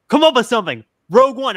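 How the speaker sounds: high, loud sketch-character shout; mock urgent call. Podcaster acts out a parody sketch for co-hosts, mock urgent.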